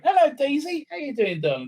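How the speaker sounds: high, sing-song greeting to a pet; doting pet voice. Real, not acted. Presenter greeting the dog, doting pet talk. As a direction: coo to a beloved dog.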